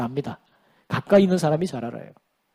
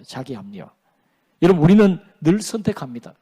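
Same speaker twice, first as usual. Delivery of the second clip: usual speaking voice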